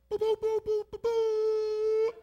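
high, loud boo-boo gibberish standing in for curses; mock cursing noise. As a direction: blurt nonsense syllables like bleeped swearing. Voicing Peter's cursing as noise.

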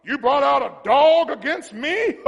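loud, booming taunt ending in a laugh; scornful Goliath. Acted. Goliath jeering at David, loud and scornful. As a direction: roar it mockingly, then laugh. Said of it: "when David saw Goliath".

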